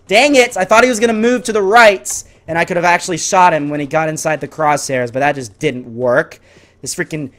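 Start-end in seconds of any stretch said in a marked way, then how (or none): none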